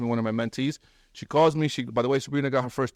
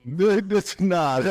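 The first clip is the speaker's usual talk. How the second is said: laughing; 6 semitones higher than usual. Real, not acted. Mocking the realtor's claim.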